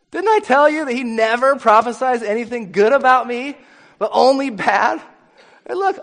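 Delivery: loud, high-pitched griping; indignant king. Acted. King complains to his ally about the prophet, indignant. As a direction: whine and rant as the thwarted king.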